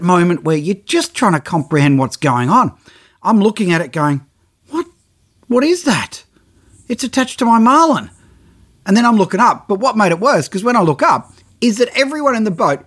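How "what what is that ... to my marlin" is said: stunned, high, half-asking; retelling his own baffled disbelief; bewildered. Retold or imitated. Diver seeing a mako on his marlin, stunned.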